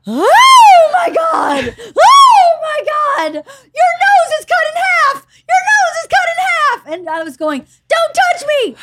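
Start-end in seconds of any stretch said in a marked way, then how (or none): 0.1-6.8 high, loud wail of horror; drawn-out hysterical cries, hammed-up; panicked screaming. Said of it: "He was screaming at me". 7.9-8.7 high, loud panicked protest; retold screaming. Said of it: "So I was screaming".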